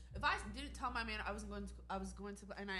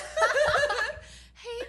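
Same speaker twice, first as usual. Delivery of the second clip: big high burst of laughter; loud laugh; 13 dB louder than usual. Real, not acted. Bursts out laughing at role play idea.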